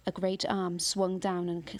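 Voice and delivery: ordinary delivery, nothing marked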